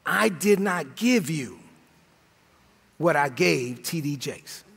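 low, very slow, weighty pronouncement; God's verdict, drawn out with long pauses; slow, deep divine voice, retold; voice of the Lord. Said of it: "the Lord said to me".

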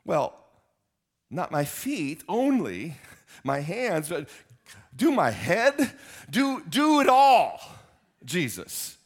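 high, loud eager plea; begging to be washed all over, rising; eager Peter. Retold or imitated. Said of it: "Peter goes".

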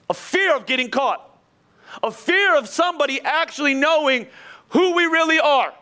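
preacher building to a peak; rising intensity. Acted. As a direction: build the fear until it bursts out. Preacher builds on fear of exposure.

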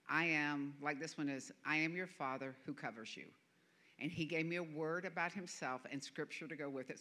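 ordinary delivery

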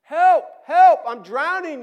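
frantic high scream for help; desperate drowning cry; shout. Acted. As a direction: yell as if going under. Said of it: "you shout".

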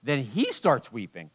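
ordinary talk

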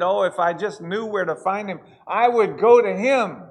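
loud, rising preacher's cry, leads held hard; preaching at full force. Acted. Preacher pleading with the Smithfield church, loud and fervent. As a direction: pour it out like a revival preacher.

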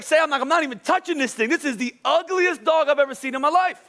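high-pitched comic protest; worked-up mock disgust; retold disgust. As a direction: refuse in exaggerated revulsion. Retold refusal to touch dog.

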